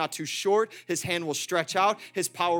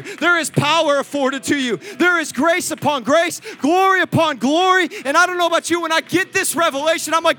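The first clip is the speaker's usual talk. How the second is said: building to a loud, higher preaching climax; full-force preaching; 7 semitones higher, 11 dB louder than usual. Acted. Pastor rallying the church to stand and worship, shouting. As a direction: rise to a shouting crescendo of praise.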